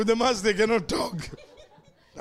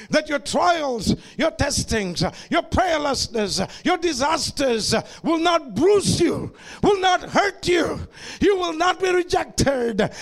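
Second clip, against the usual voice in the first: preaching at full force; 4 semitones higher than usual. Acted. Preacher promising protection to the congregation, full force. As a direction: press every promise harder.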